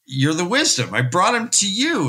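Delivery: pleading harder with the healer; rising plea. Retold or imitated. Son pleading, second plea.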